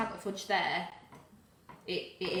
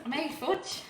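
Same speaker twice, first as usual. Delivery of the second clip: laughing; 8 semitones higher than usual. Real, not acted. Joking reply about strong arms.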